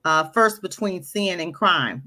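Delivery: usual speaking voice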